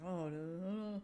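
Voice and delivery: lightly voiced Thai-accented babble; a hint of a Thai taxi driver's accent; mimicking driver's phone chatter. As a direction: imitate the driver mumbling on the phone. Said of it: "He's like".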